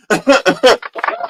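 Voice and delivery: high, loud burst of laughter; real laugh. Real, not acted. Streamer laughs at own joke to the chat, high and loud. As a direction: let a real laugh break out.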